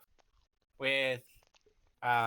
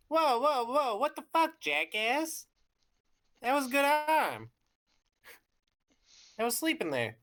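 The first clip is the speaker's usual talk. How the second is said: higher, indignant startled creature; startled grumpy Drachmon; 10 semitones higher, 3 dB louder than usual. Acted. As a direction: protest at being woken abruptly. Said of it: "Drachmon will get up in a hurry".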